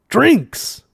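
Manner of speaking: sharp high burst of delight; excited cry. Acted. Zaphod crying out at the mention of drinks, excited. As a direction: pounce on the word with glee. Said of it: "cried Zaphod".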